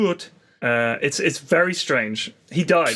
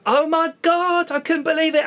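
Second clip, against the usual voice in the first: acted shock; 10 semitones higher than usual. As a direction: stretch out a mock "oh my God" in a raised pitch. Retelling his shocked reaction.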